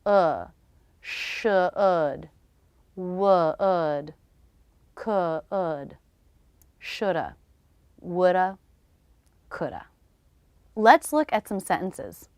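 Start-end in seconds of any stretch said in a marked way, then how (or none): 1.0-6.0 should would could with no L sound; slow demo, L silent. Shown as a demonstration. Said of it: "the L is silent in these words". 6.8-9.9 careful teacher demo of shoulda woulda coulda; reduced modal forms. Shown as a demonstration. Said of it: "reducing the ah vowel to the schwa".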